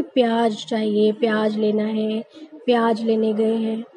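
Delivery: प्याज said "pyaaj" each time; common j mispronunciation. Shown as a demonstration. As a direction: end every प्याज on j instead of z. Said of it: "उसका गलत उच्चारण होता है".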